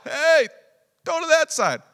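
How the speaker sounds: very high, loud yell. Acted. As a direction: shout the tip across the water. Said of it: "somebody yells out".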